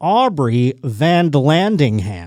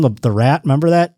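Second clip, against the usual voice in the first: ordinary talk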